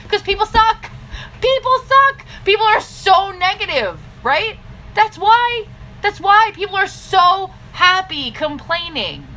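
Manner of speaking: high, loud fed-up rant; venting to distributors about complainers, loud and exasperated; exasperated outburst. Real, not acted. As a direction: let it burst out, fed up with people. Ranting about negative people.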